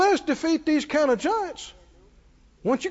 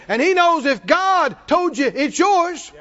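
normal talking voice

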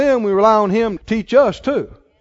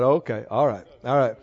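soft, yielding agreement; 9 semitones lower, 7 dB quieter than usual. Retold or imitated. His own retold answer, yielding.

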